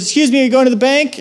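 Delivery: high, quick officious question; imitating a parking attendant lady; lady's challenge. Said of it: "she goes".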